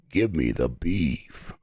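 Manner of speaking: low, slow gravel growl; gravelly voice. Shown as a demonstration. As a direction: drag it out low and rough. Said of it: "There we go".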